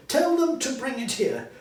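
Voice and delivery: laughing through the order; high gleeful laughing order. Acted. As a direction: chuckle while you say it. Gleeful Scrooge.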